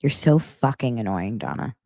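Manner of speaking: low, fed-up husband grumble; annoyed Lance. Retold or imitated. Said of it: "He'd be like".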